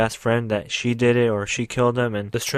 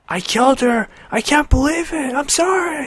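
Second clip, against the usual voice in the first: much higher, louder anguished wail; crying out; 5 dB louder than usual. Retold or imitated. Kotetsu blaming herself for the death, anguished wailing. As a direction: shriek the guilt as if breaking down in tears. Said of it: "she starts crying her eyes out".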